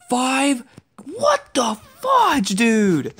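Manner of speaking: high, slow, stretched-out yell; shocked shout. Real, not acted. Gamer sees five players at once, high stretched shout. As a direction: yell it in disbelief, drawing it out.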